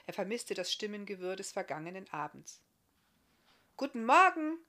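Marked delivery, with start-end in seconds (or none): 3.8-4.6 higher, louder called greeting; calling out. Acted. Barthamiel calling good morning from his bed, loud. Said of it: "rief er von seinem Lager".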